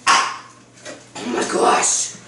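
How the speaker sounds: slow, strained, breathy whisper; Batman voice. Real, not acted. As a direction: rasp it out like the Dark Knight. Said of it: "You sound like Batman there".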